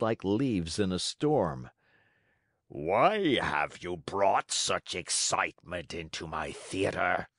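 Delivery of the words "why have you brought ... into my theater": slow, lowish ogre voice with a head cold; a lightly voiced ogre director questioning Pinocchio. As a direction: growl it out like a stuffy giant. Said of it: "with the voice of an ogre suffering with a cold".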